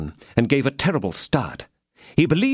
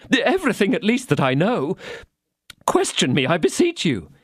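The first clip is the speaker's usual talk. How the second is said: high, hurried, pleading little shopkeeper; frightened Bonacieux; 10 semitones higher than usual. Acted. Bonacieux pleads with the commissary, high and fast. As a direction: rush to prove you'll cooperate.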